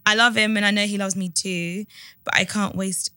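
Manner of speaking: measured, subdued read-aloud voice; letter reading. A narrator's reading. Host reading a listener's dilemma to co-host, subdued read-aloud.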